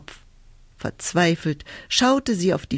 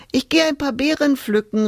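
calling out; 8 semitones higher than usual. Acted. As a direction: call it out across the house. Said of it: "rief sie der Mutter zu".

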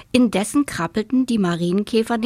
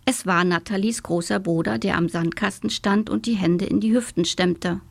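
plain reading voice

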